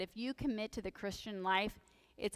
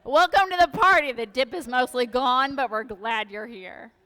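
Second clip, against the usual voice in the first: loud welcome; 2 semitones higher, 13 dB louder than usual. Real, not acted. Pastor teasing latecomers in the congregation, loud playful welcome. Greeting late arrivals loudly.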